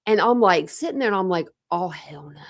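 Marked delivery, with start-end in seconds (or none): 1.7-2.4 hushed, breathy aside; low stunned whisper; whispered disbelief. Retold or imitated. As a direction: whisper it as a shocked reaction to yourself. Retelling stunned reaction while listening.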